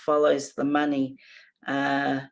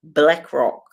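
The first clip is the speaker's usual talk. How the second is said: ordinary talk, nothing marked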